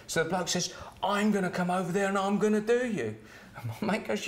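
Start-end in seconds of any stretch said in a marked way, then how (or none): 1.0-3.2 high mock-yelled threat; raised, menacing challenge; retold angry yelling. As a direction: imitate a raging bloke threatening from across the road. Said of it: "the bloke was screaming some more".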